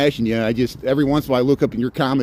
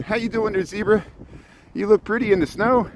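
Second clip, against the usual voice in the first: higher sing-song greeting to an animal; sweet talk; 7 semitones higher than usual. Real, not acted. Greeting a zebra in the snow, higher sing-song coo.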